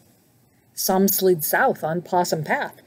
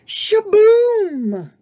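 high, loud burst over the din; exasperated shout; 12 semitones higher, 6 dB louder, much slower than usual. A narrator's reading. Snake silences the noisy musicians, exasperated high shout. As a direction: shout them down, pitch way up. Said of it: "shouted Snake".

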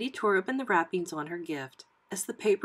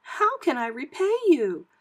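high, louder character voice; grateful woman; 7 semitones higher, 5 dB louder than usual. A narrator's reading. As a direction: ask with high-pitched gratitude.

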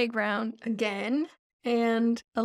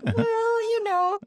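whiny cat excuse; 11 semitones higher, slower than usual. Retold or imitated. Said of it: "And he's like".

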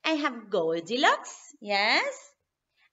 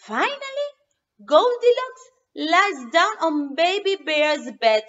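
plain reading voice

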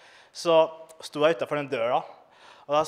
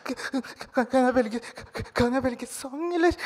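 mock tearful kid; 9 semitones higher than usual. Comic voices his child self sniffling.

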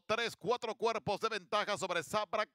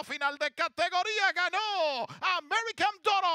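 horse race caller; 5 semitones higher than usual. Real, not acted. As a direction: call the home stretch up to a roar. Home stretch of horse race.

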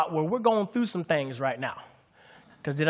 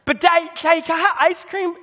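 put-on much higher and louder, whiny toddler; pleading little girl voice; 10 semitones higher, 4 dB louder than usual. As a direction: beg Daddy like a small child. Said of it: "She's like".

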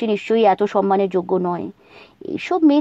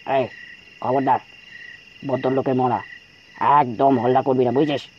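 low, hushed angry growl; whispered fury; 8 semitones lower than usual. Acted. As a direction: snarl it under the breath, very low. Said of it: "চাপা গলায় গর্জে উঠল".

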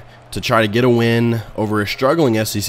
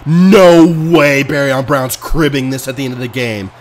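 loud disbelief; 2 semitones higher, 6 dB louder than usual. Real, not acted. Kick return touchdown against him.

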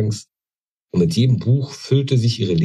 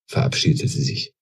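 usual speaking voice

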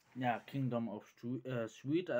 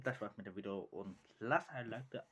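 ordinary talk, nothing marked

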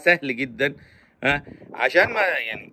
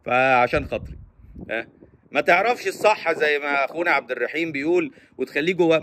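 ordinary talk

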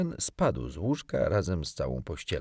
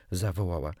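ordinary delivery, nothing marked

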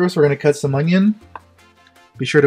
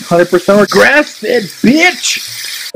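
excited; 5 semitones higher, much slower than usual. Pretend. Hyped shout about the meat.

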